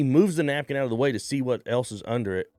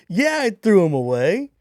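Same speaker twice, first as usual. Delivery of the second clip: high, loud cheeky admission; mock shout; 8 semitones higher, 7 dB louder than usual. Telling the dealer he threw them away, loud cheeky reply. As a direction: toss it back proudly and loud.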